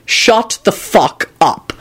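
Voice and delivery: slow, menacing command; threatening yell. A narrator's reading. Father silencing Kenny at dinner, threatening. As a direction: draw out each word as a threat. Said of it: "Quentin yelled threateningly".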